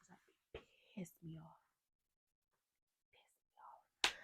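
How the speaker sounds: annoyed whisper to the listener; hushed, voiceless confession; whispered aside. Real, not acted. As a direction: drop to a whisper, as if confiding irritation. Mouths her annoyance as an aside.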